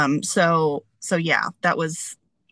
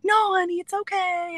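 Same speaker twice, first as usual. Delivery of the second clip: high, gentle motherly voice; soothing mom; 13 semitones higher than usual. Retold or imitated. Mom comforting her daughter about the dog, high and gentle.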